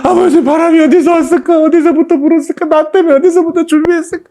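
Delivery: high, choked praying voice; high, tearful awed prayer. Retold or imitated. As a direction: pray through tears, pitched high. Reliving tearful prayer in car.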